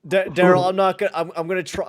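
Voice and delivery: high, loud, hammed-up choked voice; mock crying. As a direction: pretend to be on the verge of tears. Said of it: "I'm trying not to cry".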